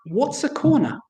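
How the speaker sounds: put-on sweet innocent child. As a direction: ask it innocently, like a little kid. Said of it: "very sweetly and innocently".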